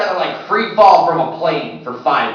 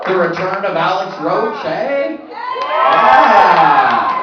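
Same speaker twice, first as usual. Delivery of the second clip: hype shout; 4 semitones higher, much slower than usual. Real, not acted. Comic hypes the crowd.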